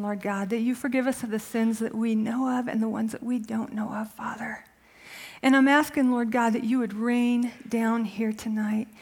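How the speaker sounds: soft, low prayer voice; hushed opening prayer before a sermon. Real, not acted. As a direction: pray quietly and earnestly.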